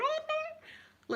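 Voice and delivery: very high, soft dismayed squeak; horrified squeal. Retold or imitated. Watching the seagull drag the pigeon, a high horrified squeal. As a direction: squeak out your horror. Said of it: "and I'm going".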